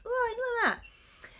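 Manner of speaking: a high, slow, showy oh; high drawn-out self-admiring oh; mock proud. Real, not acted. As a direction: admire her own work with mock pride. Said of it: "little round of applause".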